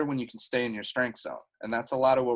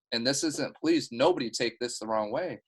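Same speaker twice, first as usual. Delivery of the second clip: normal talking voice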